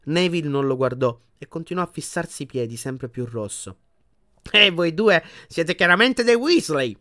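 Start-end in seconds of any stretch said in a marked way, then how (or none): 4.5-6.9 put-on higher, slow grand old lady voice; a stately grandmother paying a compliment; formidable old witch. A narrator's reading. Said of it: "con gesto regale". (anziana strega solenne, voce acuta)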